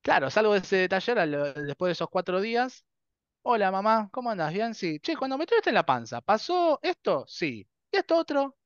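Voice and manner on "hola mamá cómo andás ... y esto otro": high-pitched, acted chat with mum; acted eager questioning. Child quizzing mum after the workshop, acted eager questioning. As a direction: fire questions in a high, sing-out retelling voice.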